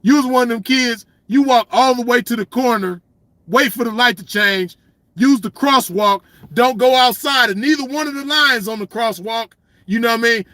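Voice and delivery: ordinary delivery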